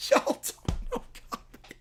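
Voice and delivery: laughing through it. Acted. Catchphrase laughed out.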